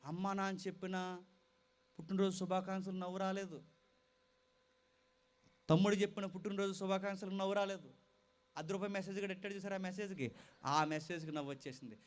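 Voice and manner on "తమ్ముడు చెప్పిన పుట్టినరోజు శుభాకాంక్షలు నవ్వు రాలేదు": normal talking voice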